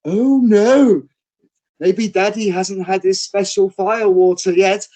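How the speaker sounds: high, loud, slow dame voice mocking daddy; panto dame teasing. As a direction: tease the dads like a pantomime dame. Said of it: "the dame goes".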